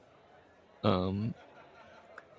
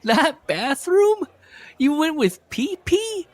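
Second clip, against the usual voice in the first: mock disbelief; 4 dB louder, slower than usual. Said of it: "I was like".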